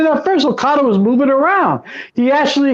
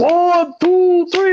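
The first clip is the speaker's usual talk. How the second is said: higher, stretched-out count with Italian-sounding vowels; imitated referee count; 8 semitones higher, slower than usual. Retelling the shock pinfall, mock referee count. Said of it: "sound like the referee was Italian".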